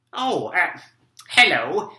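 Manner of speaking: normal talking voice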